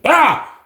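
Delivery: short high "ah"; quick high-pitched cry of triumph; excited yelp. Retold or imitated. Storyteller seeing twenty-five hundred on the card, high yelp.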